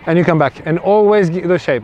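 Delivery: ordinary delivery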